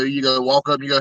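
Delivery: ordinary delivery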